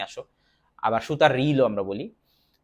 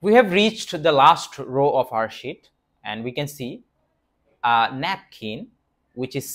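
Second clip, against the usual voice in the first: We have reached spoken very slowly, deliberate; slow English read; much slower than usual. Shown as a demonstration. Teacher to learners, slow deliberate English. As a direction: read the line slowly and evenly. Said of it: "switches to slow English read".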